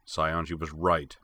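ordinary delivery

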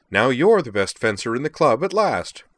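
high, loud, merry parting jab; cheerful. A narrator's reading. Sky leaving the fuming captain behind, cheerful. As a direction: deliver the jab with good cheer. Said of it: "he said cheerfully".

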